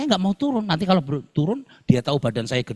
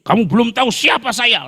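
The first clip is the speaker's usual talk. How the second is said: much higher, louder furious retort; mock furious driver threatening; angry retort; 9 semitones higher, 5 dB louder than usual. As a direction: shout it like an arrogant rich man. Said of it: "dia bilang".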